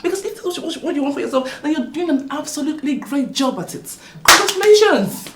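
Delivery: high, loud sarcastic jeer; mocking taunt. Sister-in-law loudly mocks the wife's failing marriage. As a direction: taunt her with mock praise.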